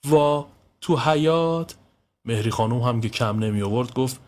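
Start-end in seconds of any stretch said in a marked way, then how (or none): 0.1-1.7 raised-eyebrow surprise. A narrator's reading. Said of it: "ابرو بالا انداخت".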